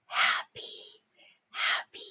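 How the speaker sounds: soft breathy whisper of the word. Shown as a demonstration. Teacher whispering the dictation word to the class. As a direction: whisper it like a secret. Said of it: "dictation drill, word repeated".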